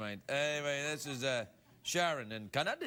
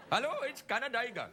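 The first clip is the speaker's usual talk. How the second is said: higher, lightly voiced Indian operator; comic Indian phone greeting; Indian call-centre accent; 5 semitones higher than usual. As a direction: answer in a mock Indian accent. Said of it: "outsourced the entire country of Canada to India".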